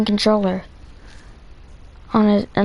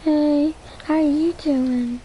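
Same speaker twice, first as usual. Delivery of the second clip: high put-on girly greeting, a bit less lifted; testing his fake girl voice on a friend; girl voice again; 7 semitones higher than usual. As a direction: lift into a girl's voice for a second try. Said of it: "Does it sound like a girl?".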